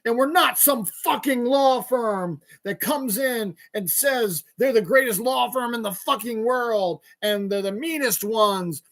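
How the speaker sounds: mocking, worked-up tough-guy rant; mock upset. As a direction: imitate an ad man building to loud, high outrage. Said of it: "he's all upset about it".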